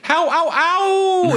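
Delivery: high squeaky book quote; quoting a book phrase. Retold or imitated. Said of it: "twenty-two times the phrase".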